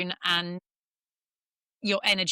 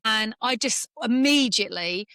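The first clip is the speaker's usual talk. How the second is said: ordinary talk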